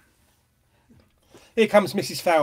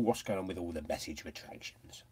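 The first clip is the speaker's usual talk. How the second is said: whisper; 8 semitones lower, 12 dB quieter than usual. Real, not acted. Muttering to herself over retractions.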